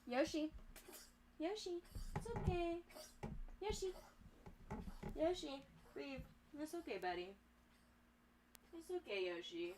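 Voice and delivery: soft hushed comforting whisper; gently calm a frightened pet; soothing a scared dog. Real, not acted. Calming her wheezing sick dog.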